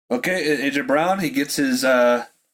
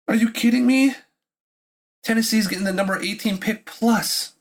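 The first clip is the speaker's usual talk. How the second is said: 1 disbelief; 6 semitones higher than usual. Real, not acted. Stunned by the AJ Brown trade.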